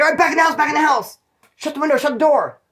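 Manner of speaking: high, loud, fast orders to get inside; urgent yell. Retold or imitated. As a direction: shout everyone back into the house. Yelling friends inside at gunman.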